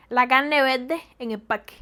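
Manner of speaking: slow, "carne verde en el parque" as "canne vedde en el pakke"; Havana dropped R. Shown as a demonstration. Demonstrating Havana talk, "carne", "verde", "parque" all without R. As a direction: swallow every R in "carne", "verde", "parque", slowly. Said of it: "Hablan así".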